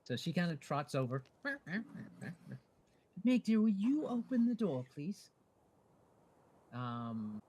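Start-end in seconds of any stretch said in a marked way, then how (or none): 3.2-5.3 high, loud sweet ask that softens at the end; Erin, sweet request. Acted. Erin sweetly asks Meg to open the wagon door, high voice. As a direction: ask nicely, high and sugary.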